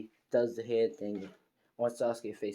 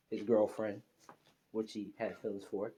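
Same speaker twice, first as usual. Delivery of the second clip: ordinary delivery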